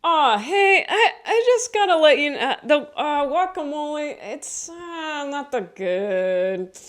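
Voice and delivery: high, loud, sugary apologetic voice; overly nice customer complaint; people-pleaser voice. Pretend. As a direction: break the bad news as sweetly as possible. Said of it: "I would probably go".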